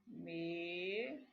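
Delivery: "Me-" held long and low; slow, low syllable-by-syllable dictation; sounding out. Shown as a demonstration. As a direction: draw out "Me-" while writing it. Sounding out while writing the word.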